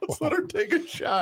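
high, sing-song mocking voice; a hammed-up upbeat coach cheering; mock cheerful coach. Mocking the water boy scenario.

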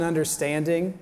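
usual speaking voice

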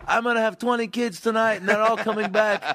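high mock parting shout; mock parting shot. Heckler's parting shot while thrown out, high and mocking. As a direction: toss a high defiant last word. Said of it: "you say one last thing like".

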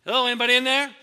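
loud door-to-door greeting; calling at the door. Shown as a demonstration. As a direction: call out so someone inside hears. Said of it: "You gotta go up and go".

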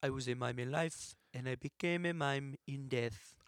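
put-on subdued French-accented mime. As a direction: play a dead French mime, softly. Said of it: "my French accent isn't that good".

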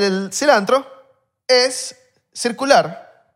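es stretched slow and high; slow emphasis. Shown as a demonstration. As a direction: draw out es circular slowly. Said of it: "teaching the herb trick".

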